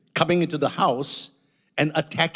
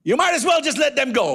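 full-force preaching; 9 semitones higher than usual. Acted. Preacher rebuking hirelings, full-force preaching. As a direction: preach it out to the congregation.

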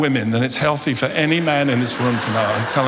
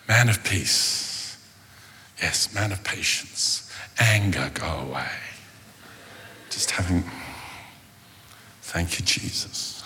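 slow and much softer, drifting down to a whisper; mock calm mantra; 7 semitones lower, 8 dB quieter, slower than usual. Pastor calming himself after shouting at daughter, whispered mantra. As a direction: talk himself out of anger. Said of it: "I'm having my Zen moment".

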